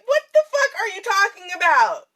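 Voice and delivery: acted outburst at a friend; incredulous retort. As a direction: scold a friend in shocked disbelief.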